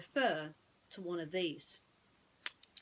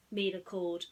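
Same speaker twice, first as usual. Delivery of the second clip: ordinary delivery, nothing marked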